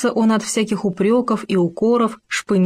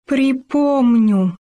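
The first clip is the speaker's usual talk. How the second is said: dreadful word, forced out; 5 semitones higher, much slower than usual. A narrator's reading. Gopher forcing out the bear's dreadful word to Khoma, slow and strained. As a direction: squeeze out «Припомню» slowly as if it scares you. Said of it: "с трудом вымолвил страшное слово".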